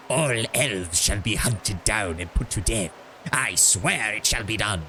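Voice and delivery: slow, rasping Sith-lord menace; Palpatine voice. Shown as a demonstration. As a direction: drawl it out like a gloating dark emperor. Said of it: "I'm gonna give this guy Palpatine voice".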